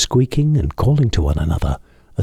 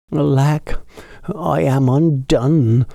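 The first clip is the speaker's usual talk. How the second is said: higher, slow woeful sigh; the tailor giving up and going sadly to bed; despairing tailor; 7 semitones higher, slower than usual. A narrator's reading. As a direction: let it fall in despair. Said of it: "went sadly to bed".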